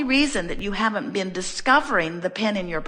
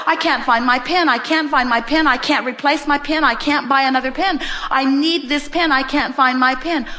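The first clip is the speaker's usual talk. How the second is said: higher and louder, mock panic over the pen; hammed-up distress, each line pushed up; frantic pen search; 6 semitones higher, 5 dB louder than usual. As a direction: fret like a desperate owner. Retold frantic searching again.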